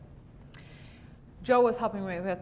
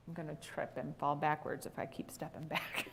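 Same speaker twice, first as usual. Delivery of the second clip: whispered aside; 5 semitones lower, 10 dB quieter than usual. Real, not acted. Aside while teaching.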